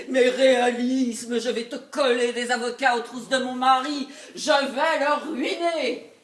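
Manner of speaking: loud, emphatic threat; vindictive. Acted. Wife planning to set lawyers on her husband, loud vindictive threat. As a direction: declare the revenge loudly, hitting it hard.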